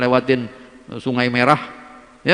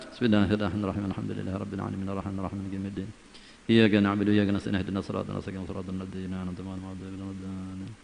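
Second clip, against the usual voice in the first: soft fast murmured Fatihah; rapid Fatihah recitation; 3 semitones lower, 7 dB quieter, faster than usual. Acted. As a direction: murmur the Fatihah quickly under the breath. (membaca Al-Fatihah cepat dan lirih)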